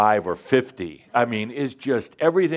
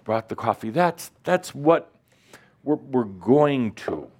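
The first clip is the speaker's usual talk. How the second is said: normal talking voice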